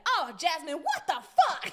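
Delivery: furious mom to daughter; mom's outrage. Retold or imitated. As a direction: blast it like a mom seeing the damage.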